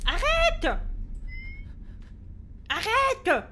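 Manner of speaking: frightened, high-pitched outburst; scared shout. Real, not acted. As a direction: cry out to make it stop. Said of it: "J'ai peur".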